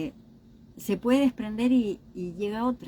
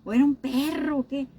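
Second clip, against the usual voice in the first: put-on higher, raised voice brushing off the child's grief; dismissive scoff; 6 semitones higher, 3 dB louder than usual. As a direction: scoff that it was only a pet. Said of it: "uno le dice". (adulto que le resta importancia a la mascota muerta)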